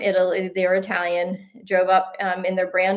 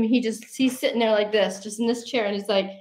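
ordinary talk, nothing marked

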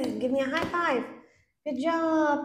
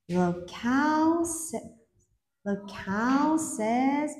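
slow, drawn-out prompt; much slower than usual. Shown as a demonstration. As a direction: stretch it out for a toddler. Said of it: "teacher drawing out the answer".

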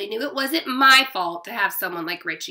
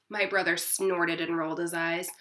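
ordinary delivery

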